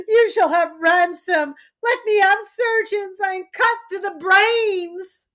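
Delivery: a broken king half laughing half sobbing; crazed weeping king. Acted. Lear laughs and cries madly.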